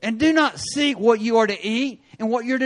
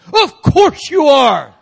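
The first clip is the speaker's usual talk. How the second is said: sarcastic cop; 7 semitones higher, 12 dB louder, slower than usual. Retold or imitated. Officer sneering at the reply.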